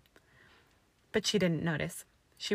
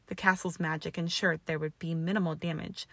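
ordinary delivery

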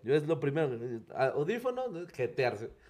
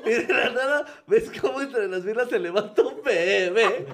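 laughing while telling; 6 semitones higher, 7 dB louder than usual. Real, not acted.

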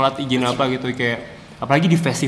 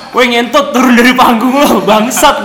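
loud, high heckler yell; shouted heckle; 10 semitones higher, 12 dB louder, slower than usual. Retold or imitated. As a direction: bellow it like an angry heckler. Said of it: "Gue diteriakin".

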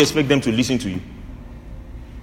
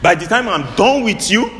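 mock threat; 7 semitones higher than usual. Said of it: "Some people say".

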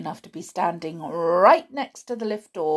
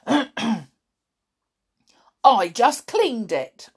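slow, indignant gruff protest; a cleaner defending her mopping; 4 semitones higher, 4 dB louder, slower than usual. A narrator's reading. Dilly protesting to Tom about the floor, slow indignant. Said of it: "protested Dilly".

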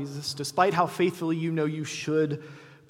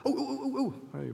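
eager disciples; 11 semitones higher, 4 dB quieter than usual. Acted. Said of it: "The disciples are like".

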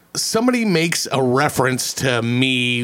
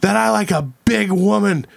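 mock stage announcement, pitched up; hammed-up movie quote, higher; proudly announcing to a crowd, a bit higher; reciting a movie line; 7 semitones higher than usual. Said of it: "I start reciting the lines from Summer Catch".

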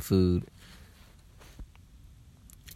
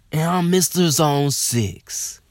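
higher, louder, slow proud hype-man voice; swagger boast; 8 semitones higher, 5 dB louder, slower than usual. Retold or imitated. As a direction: announce yourself like a neighborhood legend. Bragging hometown persona.

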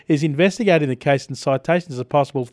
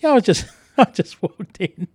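normal talking voice

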